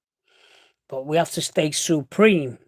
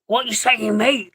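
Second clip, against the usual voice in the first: higher-pitched mock Salford accent; 7 semitones higher, 4 dB louder, slower than usual. Mimicking Salford people for a mate, mock Salford accent. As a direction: put on a rough-and-ready Salford accent. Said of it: "when you listen to Salford people".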